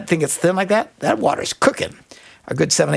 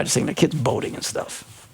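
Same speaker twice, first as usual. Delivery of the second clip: ordinary delivery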